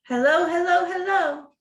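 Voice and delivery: high, loud rooster greeting; rooster calling. Acted. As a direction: call out to the farm like a cheerful rooster. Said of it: "The rooster calls out to his friends".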